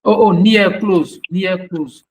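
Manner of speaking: ordinary talk, nothing marked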